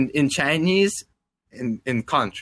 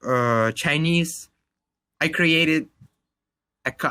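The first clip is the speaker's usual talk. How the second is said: normal talking voice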